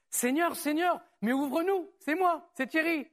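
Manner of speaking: pleading. Acted. Shut-out virgins begging the Lord to open, pleading. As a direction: call to be let in, voice lifted.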